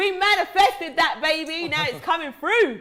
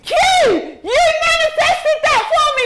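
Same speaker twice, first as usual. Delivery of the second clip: high, loud cries of joy; thrilled shouting; 14 semitones higher, 7 dB louder, slower than usual. Real, not acted. Host celebrating her lucky draw, thrilled shout. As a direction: shout out the win, over the moon. Winning the team draw live.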